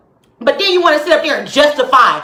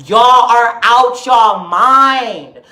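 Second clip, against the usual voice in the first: very slow, lower, drawn-out rebuke; slow, weighty exasperation; appalled disbelief; 4 semitones lower, much slower than usual. Real, not acted. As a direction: stretch every word, pitched down in disbelief. Appalled at the comparison.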